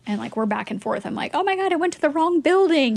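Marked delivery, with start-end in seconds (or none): 1.3-3.0 flustered panic. Retold or imitated. Panic over the wrong building.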